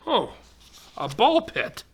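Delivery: plain reading voice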